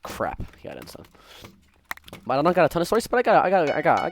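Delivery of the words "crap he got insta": low, breathy whispered curse. Real, not acted. Gamer whispering to himself as his game goes wrong, hushed. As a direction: hiss it under your breath in dismay.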